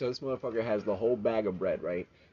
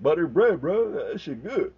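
higher, louder goofy voice; imitating coworker; 7 semitones higher, 6 dB louder than usual. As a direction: ham up the coworker. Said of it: "He's like".

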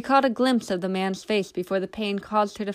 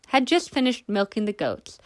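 ordinary delivery

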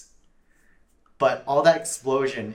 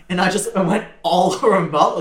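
ordinary talk, nothing marked

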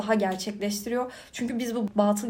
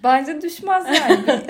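slow, laughing, higher and louder; 6 semitones higher, 7 dB louder, slower than usual. Real, not acted. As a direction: answer through a laugh. Answering a joking question. (gülerek, yavaş)

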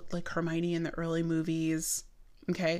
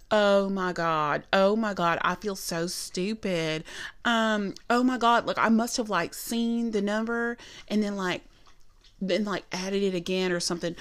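put-on Southern accent. Manager mimicking server who got caught, Southern accent. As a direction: imitate a very Southern waitress, flustered. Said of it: "because she's so Southern".

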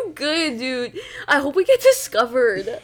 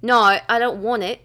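slow, stretched Australian; Australian accent. Shown as a demonstration. Showing off to a friend, slow Australian accent. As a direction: draw out an Australian "No". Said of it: "now do Australian".

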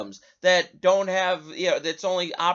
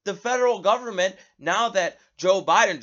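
ordinary talk, nothing marked

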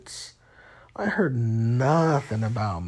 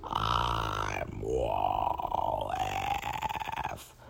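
low, menacing robot; evil robot voice; 6 dB quieter, much slower than usual. Acted. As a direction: growl threats as an evil robot. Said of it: "a better robot voice".

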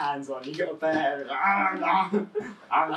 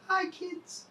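Ronald McDonald voice; 5 dB quieter than usual. Retold or imitated. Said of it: "Ronald McDonald on the side going".

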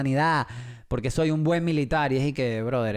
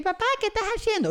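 put-on toddler voice; faster than usual. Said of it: "Un bebé de tres años".

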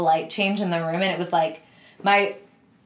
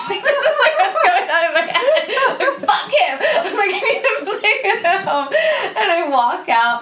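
much higher, louder, laughing through every word; laughing hard; 11 semitones higher, 4 dB louder than usual. Real, not acted. Recounting her own furious thoughts to the cohost, laughing hard. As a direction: cackle your way through the memory.

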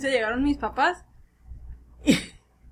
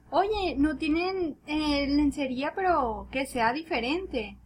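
slow coworker; 3 dB quieter, slower than usual. Retold or imitated. Coworker quoted slowly.

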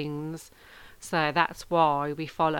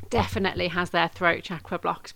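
usual speaking voice

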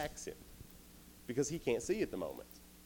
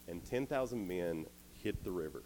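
ordinary talk

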